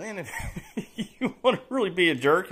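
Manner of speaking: laughing. Real, not acted. Chuckling to viewers at his own cheeky idea, laughing. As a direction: laugh while you suggest being a jerk. Laughing about being a jerk.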